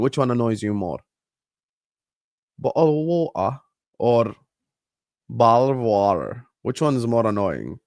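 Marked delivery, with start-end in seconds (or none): 2.6-3.6 British "Bottle of water", glottal t in "Bottle" and r-less "wa-uh"; "Bottle of water" with dropped t and r; British pronunciation. Shown as a demonstration. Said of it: "the British pronunciation". 5.3-6.4 American "bottle of water?" with flapped t's and a strong r at the end; "vadder" with a full r; American pronunciation. Shown as a demonstration. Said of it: "or the American pronunciation".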